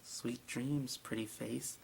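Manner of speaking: soft menacing murmur; sinister whispered goodbye; creepy whisper. Acted. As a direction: purr it over the victim. Beast's line before kill.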